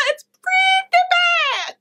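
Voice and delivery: very high, drawn-out comic squeal; squealed, over-the-top verdict; exaggerated squeal. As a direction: stretch it out in a mock high-pitched outburst. Exaggerated verdict on the bad accent.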